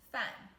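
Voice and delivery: 饭 said as a sharp falling fàn; teacher showing viewers the fourth tone on 饭, crisp drop; fourth tone demo. Shown as a demonstration. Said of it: "the fourth tone".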